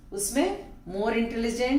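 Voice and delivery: ordinary talk, nothing marked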